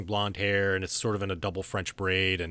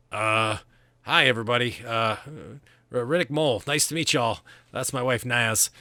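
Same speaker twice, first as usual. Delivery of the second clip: awkward, slightly higher-pitched greeting; sheepish; 3 semitones higher, 5 dB louder than usual. Acted. Punched husband introduces himself to the group, mildly sheepish. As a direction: introduce yourself with hesitant, halting starts. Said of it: "he sort of sheepishly kinda comes over".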